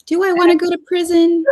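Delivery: high-pitched mock deliberation; mock pondering. Co-host playing the woman weighing prison, mock pondering. Joining the mock first-person quote.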